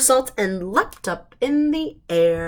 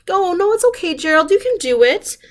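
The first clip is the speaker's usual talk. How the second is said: reassuring; 9 semitones higher, 4 dB louder, faster than usual. Shown as a demonstration.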